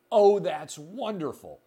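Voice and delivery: heavy sarcasm, as if dreading the houseguests; sarcastic. Acted. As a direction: gush it while meaning the exact opposite. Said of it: "meaning the exact opposite".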